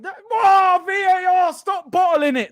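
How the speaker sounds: loud, high plea at VAR; real exasperated fan outburst; desperate shout. Real, not acted. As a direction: yell at the referee to decide. VAR review, fan pleads loudly.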